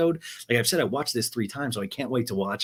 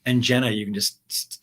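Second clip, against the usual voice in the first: ordinary delivery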